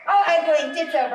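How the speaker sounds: laughing, loud. Real, not acted. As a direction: laugh at losing the contest. Lost the staring contest.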